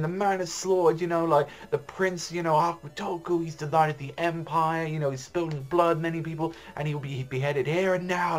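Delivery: high, ringing herald's cry; screaming proclamation. Retold or imitated. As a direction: announce the execution to the crowd. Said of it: "like that".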